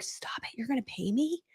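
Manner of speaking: whispered disbelief, breath only; stunned whisper. Retold or imitated. Mom hearing CEO will pay her, whispering in disbelief. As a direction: hush it like you can't believe the news. Retold disbelief at CEO's offer.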